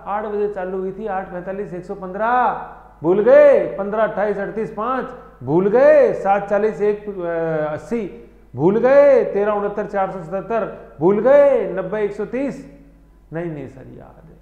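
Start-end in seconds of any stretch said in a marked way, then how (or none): 3.0-3.8 teasing loud quiz call. Real, not acted. Teacher teasing students in a quiz. 5.4-6.1 teasing loud quiz call. Real, not acted. Teacher teasing students in a quiz. 8.6-9.2 teasing loud quiz call. Real, not acted. Teacher teasing students in a quiz. 11.0-11.7 teasing loud quiz call. Real, not acted. Teacher teasing students in a quiz.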